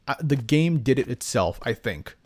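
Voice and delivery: normal talking voice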